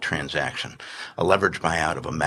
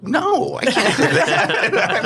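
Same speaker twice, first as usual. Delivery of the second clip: high, slow laughing "no"; laughing refusal; 6 dB louder, much slower than usual. Retold or imitated. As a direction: laugh out a panicked no. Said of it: "And I'm like".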